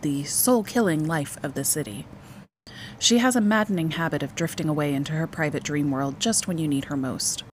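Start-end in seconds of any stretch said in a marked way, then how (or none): none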